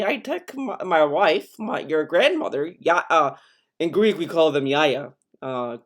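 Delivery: like a grandfather reminiscing to a grandkid; grandpa voice. Retold or imitated. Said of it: "grandpa voice".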